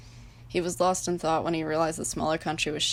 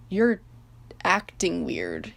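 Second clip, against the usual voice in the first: careful wording; 4 dB louder, much slower than usual. A narrator's reading. East voicing concern, worded carefully. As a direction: choose each word slowly. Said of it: "he worded carefully".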